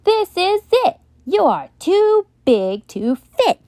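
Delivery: high, loud storybook shout at the moose. Acted. Child shouting at the moose, too big to fit, loud high shout. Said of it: "Would you shout".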